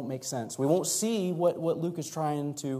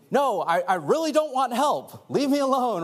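raised, emphatic mock refusal; a little testy; 8 semitones higher, 5 dB louder than usual. Acting someone refusing offered help, testy. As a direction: push the helper away with testy insistence. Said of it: "they end up getting a little testy".

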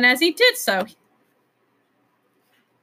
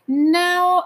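emphatic; 8 semitones higher, 6 dB louder than usual. Acted. Wayne Doodle emphatic.

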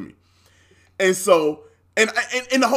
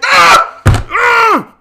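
high, full-volume outburst yell; loud retold scream; 11 dB louder than usual. Customer hearing watch was never activated, screaming loudly. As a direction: scream out in triumphant disbelief. Acts out reaction to rep's news.

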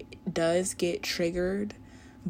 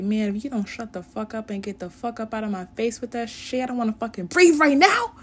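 building to a raging yell, fast at the end; whisper yelling; 5 semitones higher, 11 dB louder, faster than usual. Retold or imitated. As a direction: start annoyed, then blow up into a yell. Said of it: "whisper yelling".

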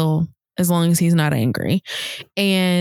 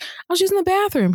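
son's excuse; 12 semitones higher, faster than usual. Retold or imitated. Mom voicing son's excuse.

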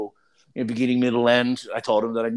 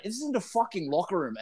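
ordinary talk, nothing marked

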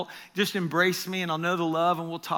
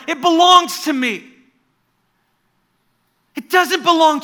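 intense demand, not angry; 12 semitones higher, 16 dB louder than usual. Retold or imitated. Jesus to Graham in a dream, loud intense plea. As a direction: demand it back with love, not rage. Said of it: "Remember, not anger in the voice".

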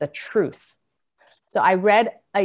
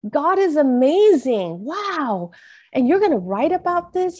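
high, wondering voice, retold; amazed son; 8 semitones higher, 4 dB louder than usual. Oldest son to mom about her story, retold amazement. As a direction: imitate an awed teen, pitched up.

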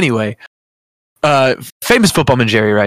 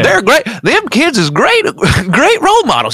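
put-on "them kids is great" like a gold miner; old prospector; 11 semitones higher than usual. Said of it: "Like an old prospector?".

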